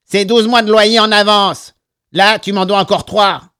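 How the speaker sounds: high, loud nasal retort. A narrator's reading. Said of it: "d'une voix plus nasillarde que jamais".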